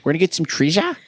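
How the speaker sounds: mock New Zealand accent, lifted pitch; imitating Omega's New Zealand accent. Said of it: "as Omega would say".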